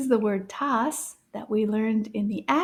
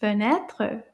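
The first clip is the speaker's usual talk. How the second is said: "Fenêtre" run together as one word; a bit faster; 3 semitones lower than usual. Shown as a demonstration. Teacher asks for a repeat, "Fenêtre" said a bit faster. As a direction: say "Fenêtre" briskly, syllables joined. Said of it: "Maybe a little bit faster".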